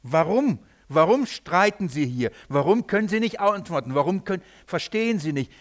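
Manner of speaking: ordinary talk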